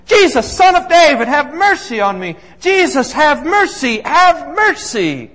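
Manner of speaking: loud high cry for mercy, stretching the last plea; desperate yelling. Retold or imitated. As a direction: call out like a blind beggar begging for help. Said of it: "he starts yelling".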